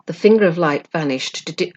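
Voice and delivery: plain reading voice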